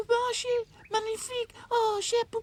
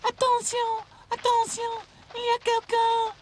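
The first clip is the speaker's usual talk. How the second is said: very high and louder, calling out; 10 dB louder than usual. A narrator's reading. French doll shouting for help from the tree, high and loud. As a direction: shout for help, closer now. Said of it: "nearer and nearer".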